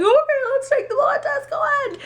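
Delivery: high, bright, overly chipper go-ahead; mock eager. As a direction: pretend you're totally confident. Mock eager denial at clinic.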